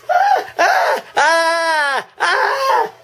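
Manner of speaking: very high shouted ah, ah, ah; hammed-up screams. Imitating Missus Deagle, very high mock screams. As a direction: scream like a cartoon victim.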